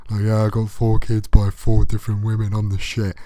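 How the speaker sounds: loud, cocky bragging lad; a hint of a smug lad; mocking smug lad. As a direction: brag like a feckless dad, loud. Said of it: "they think that's really funny".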